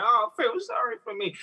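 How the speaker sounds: high, whiny mock self-pity. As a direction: parody a pitiful moan. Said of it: "that isn't me being like".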